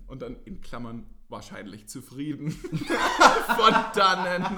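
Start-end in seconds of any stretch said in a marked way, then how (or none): 2.5-4.6 giggling through the words; laughing while reading. Real, not acted. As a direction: read it while cracking up. Reading funny line out, laughing.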